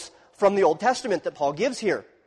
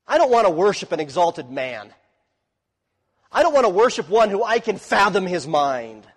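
emphatic refusal; 4 semitones higher, 7 dB louder than usual. Real, not acted. Preacher rejecting a small God.

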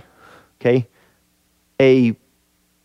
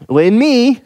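"in me" lifted higher for emphasis; emphatic; 7 semitones higher than usual. Real, not acted. Preacher repeating the key phrase of the text, emphatic "in me". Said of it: "make sure we see the emphasis of the text".

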